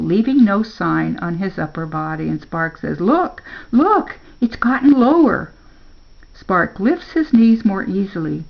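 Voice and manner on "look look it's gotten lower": high, eager exclamation; excited discovery. A narrator's reading. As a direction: point it out with rising excitement. Spark sees substance dropping.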